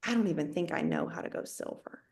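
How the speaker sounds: low, very quiet, defeated admission; retold low feeling. Wife confessing self-doubt to her husband, low and quiet. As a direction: recall feeling low, voice dropped and small. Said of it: "That's how low I was feeling".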